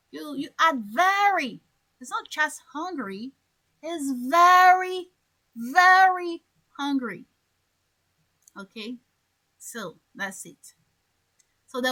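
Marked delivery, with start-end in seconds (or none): 3.8-7.2 very stretched slowly, louder; stress on very. Shown as a demonstration. Teacher emphasizing very for the class. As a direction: drag out very, very. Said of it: "when you want to emphasize".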